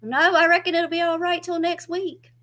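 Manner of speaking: high, loud drawling mother; mama giving in. Retold or imitated. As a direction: settle it like a stubborn old mama. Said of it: "Mama answers".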